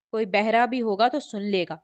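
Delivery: ordinary delivery, nothing marked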